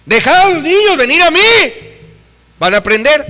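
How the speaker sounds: preached scripture again; proclaiming Jesus's words. Retold or imitated. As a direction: declare it to the church.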